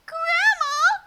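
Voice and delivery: put-on a child marveling at something odd; wide-eyed little girl. Little girl marvels at grandma's big nose. Red's voice.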